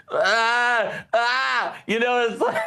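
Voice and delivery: high, thrown-off stammer; a mock flustered ah ah, high; stunned stammer. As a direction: caught off guard, at a loss for words. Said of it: "They're like".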